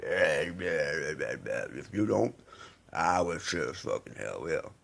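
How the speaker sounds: low, very slow growled mock voice; imitating Ducky. Quoting Ducky's threat in the link, low slow voice. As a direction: drag it out low like a menacing thug. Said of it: "Ducky going".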